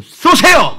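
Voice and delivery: high, loud order to fire; commanding shout. Acted. Ordering the congregation to shoot their words, loud command. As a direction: call out like a commander.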